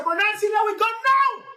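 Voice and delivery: high, loud final demand; insistent shout. Acted. Tukuma ordering Nancy out, high loud demand. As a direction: order him out now.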